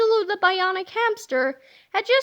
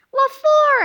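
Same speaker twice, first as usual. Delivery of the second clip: high, loud what for; surprised question; 10 semitones higher, 8 dB louder than usual. Acted. Harold is shocked by Melvin's trick, high and loud. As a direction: blurt it out in disbelief. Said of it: "asked Harold".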